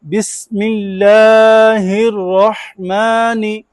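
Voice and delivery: drawn-out chanted Quran recitation; slow recitation. Shown as a demonstration. Teacher leads class in slow chanted recitation. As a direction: chant each word slowly, leaning on और. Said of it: "ये गलती ठीक कर लें".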